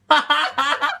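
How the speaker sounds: high, loud laughing exclamation; slow, high words through laughter. Real, not acted. Players crack up at the entrance, laughing loudly.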